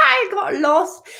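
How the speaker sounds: high, slow words through laughter; laughing. Real, not acted. Laughing at losing track.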